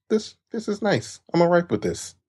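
put-on high voice, falsely content; mock self-approval. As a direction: act pleased with your own reflection. Acting someone approving their mirror image.

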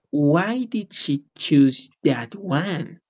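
very slow, word-by-word question; slow model sentence. Shown as a demonstration. Teacher repeats the student's answer, slowly and deliberately. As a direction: stretch each word out for the learner.

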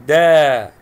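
دا held as a loud "daa"; long vowel. Shown as a demonstration. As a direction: draw out the a of دا forcefully. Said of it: "هنا مد بالألف".

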